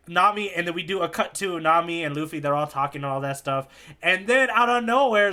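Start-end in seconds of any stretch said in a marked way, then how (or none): none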